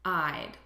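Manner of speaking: "I'd" run into one syllable; contracted form. Shown as a demonstration. Teacher has learners repeat the contraction "I'd", one syllable. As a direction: model a single-syllable "I'd".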